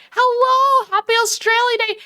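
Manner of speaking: high, bright holiday hello; cheerful high-pitched greeting. Real, not acted. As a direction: sing it up high to chat.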